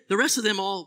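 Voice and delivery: normal talking voice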